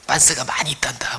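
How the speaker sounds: slow soft whisper of comfort; tender whisper. Pretend. God gently whispering to the child who wet the bed. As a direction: whisper it slowly, gently reassuring. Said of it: "뭐, 이렇게".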